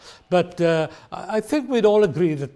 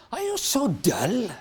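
slow, higher, sharp reproach; sharp rebuke; 5 semitones higher, 4 dB quieter, slower than usual. Retold or imitated. Jesus rebuking his disciples, slow and sharp. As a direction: scold the disciples. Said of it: "can be impolite and can be rude".

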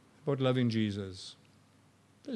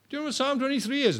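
high, fast, excited asking; eager question; 12 semitones higher, 3 dB louder, faster than usual. Retold or imitated. Dying man quizzing the doctors, high and eager. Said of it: "Doctors would come".

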